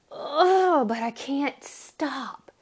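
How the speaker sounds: groan, then insist with fervour; anguished conviction. Acted. Acts out Jeremiah's torn conviction.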